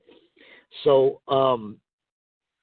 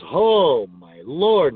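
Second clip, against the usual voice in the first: loud, drawn-out mock amazement; hammed-up shock; mock astonishment; 4 dB louder, much slower than usual. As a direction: swoop up and down in exaggerated disbelief. Acts out Onita's teasing reaction.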